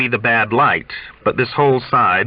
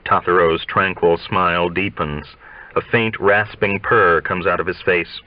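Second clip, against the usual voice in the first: ordinary delivery, nothing marked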